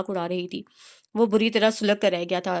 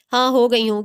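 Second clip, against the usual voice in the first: defiant shout; 4 semitones higher, 6 dB louder than usual. A narrator's reading. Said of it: "defiant shout in quarrel".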